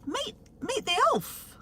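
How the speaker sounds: high squeaky elf puppet voice; chirpy little elf greeting. Pretend.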